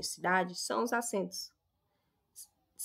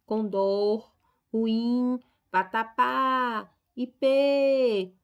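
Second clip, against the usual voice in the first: each example word spoken louder, with the last syllable punched: "condor", "ruim", "vatapá", "ipê"; stressed last syllable; 7 dB louder, much slower than usual. Shown as a demonstration. Teacher showing the class the oxytone stress, louder on the final syllable of "condor", "ruim", "vatapá", "ipê". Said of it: "Se a última sílaba for tônica".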